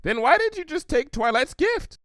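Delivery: high, curious pony voice; bubbly Pinky voice. Acted. Pinky puzzled, asking Applejack about the gift, high and bubbly. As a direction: ask it wide-eyed and bouncy.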